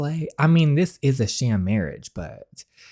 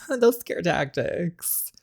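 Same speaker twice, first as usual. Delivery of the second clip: slow, whispered with a laugh; 5 semitones higher, 5 dB quieter, much slower than usual. Real, not acted. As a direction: tease under your breath. Teasing reply to accusation.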